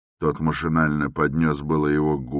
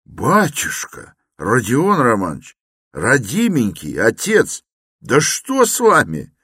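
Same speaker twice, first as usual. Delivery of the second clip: high, louder frightened pleading; alarmed pleading; 8 semitones higher, 7 dB louder than usual. A narrator's reading. Porfiry begging the agitated Raskolnikov, alarmed. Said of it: "в совершенном испуге".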